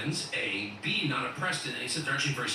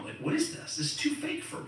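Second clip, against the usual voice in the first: much higher, exasperated disbelief; incredulous outburst. Real, not acted. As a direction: throw up your hands at the fakeness. Outraged reaction to fake accent.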